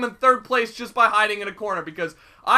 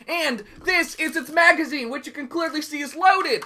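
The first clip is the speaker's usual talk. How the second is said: usual speaking voice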